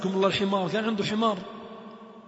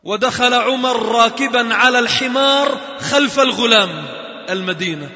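ordinary talk, nothing marked

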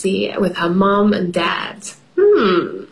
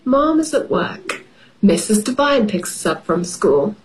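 usual speaking voice

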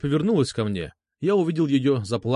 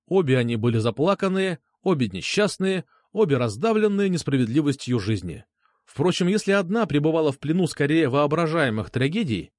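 plain reading voice

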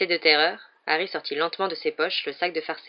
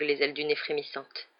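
ordinary delivery

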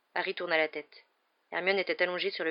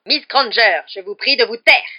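loud, higher, cutting order; angry rebuke; 5 semitones higher, 14 dB louder than usual. A narrator's reading. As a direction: silence the student sternly. Professor angrily silences student. (réprimande cinglante)

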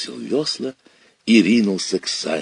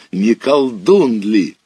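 usual speaking voice

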